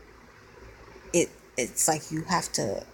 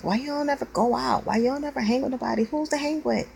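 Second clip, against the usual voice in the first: higher, mocking voice of people who question her; nosy people asking; 9 semitones higher than usual. Venting about people who don't understand, mock questioning. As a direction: poke at her the way others do. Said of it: "People never understand".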